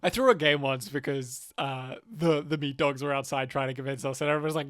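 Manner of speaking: ordinary talk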